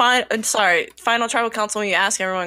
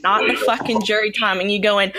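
ordinary delivery

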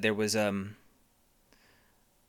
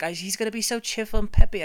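higher, louder hopeful mom; hint of eager mom; 9 semitones higher, 7 dB louder than usual. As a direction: gush like a proud mother. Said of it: "And she's like".

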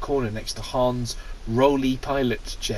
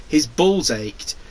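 ordinary talk, nothing marked

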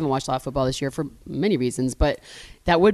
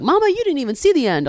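higher mock-scolding quote; friends teasing; 9 semitones higher, 4 dB louder than usual. Friends teasing her about the game, higher imitated voice. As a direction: lift it high in mild teasing.

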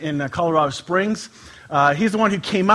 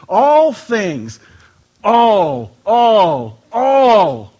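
each "all" hit louder, its own beat; stress on all; 3 semitones higher, 7 dB louder, much slower than usual. Shown as a demonstration. Pastor insisting to the congregation, punching "all". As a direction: hammer "all" again and again. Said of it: "That's how serious he is about this".

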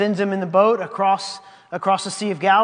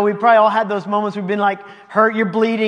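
ordinary delivery

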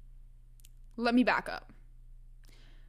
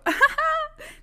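a short squeal of a laugh; sudden giggle burst; high burst of laughter; 7 dB louder than usual. Real, not acted. Laughing at own joke.

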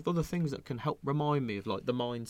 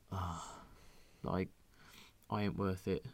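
defeated mutter; 4 semitones lower, 10 dB quieter, slower than usual. Retold or imitated. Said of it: "Then you go".

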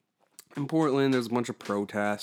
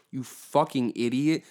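ordinary delivery

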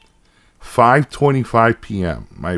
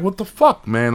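retold outburst; 7 semitones higher than usual. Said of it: "I fucking freaked, I got pissed".

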